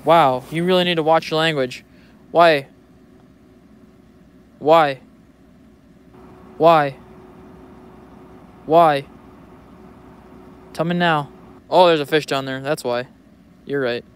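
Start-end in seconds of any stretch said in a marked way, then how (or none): 2.3-9.0 insistent why. Acted. Repeated loud questioning.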